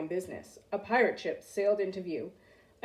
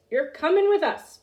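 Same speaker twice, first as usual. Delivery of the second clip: pirate captain; 7 semitones higher, 6 dB louder than usual. A narrator's reading. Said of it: "Braidbeard told me".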